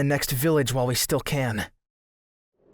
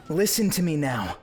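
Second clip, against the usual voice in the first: ordinary talk, nothing marked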